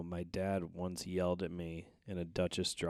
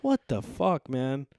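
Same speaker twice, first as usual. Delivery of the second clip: retold outrage; 7 semitones higher, 8 dB louder, slower than usual. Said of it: "I was like".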